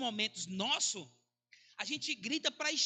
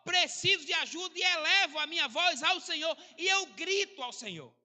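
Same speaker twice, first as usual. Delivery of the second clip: raised, higher, insistent plea; desperate crying out; 4 semitones higher, 4 dB louder than usual. Acted. Preacher voices the psalmist begging God for help, crying out desperately. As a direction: cry out to God for help, pitched up. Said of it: "que estava gritando, dizendo assim".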